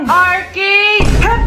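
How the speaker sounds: high, loud one-word demand; insistent shout. Acted. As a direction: shout your choice over your friend's. Friends quarrel over where to go. (mapilit na sigaw)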